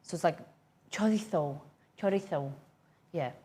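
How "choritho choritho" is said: "chorizo" with a th for the z; "chorizo" with tapped r and lisped z; Spanish pronunciation. Shown as a demonstration. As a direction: say "chorizo" the Spanish way, cho-REE-tho. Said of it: "so when you say Spanish".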